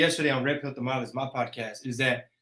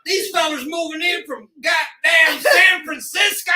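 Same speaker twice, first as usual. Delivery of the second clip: hammed-up high holler; mock Texan local; 12 semitones higher, 7 dB louder than usual. Texan griping about fellas moving in from San Francisco, mock outrage. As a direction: complain loudly as an outraged Texan.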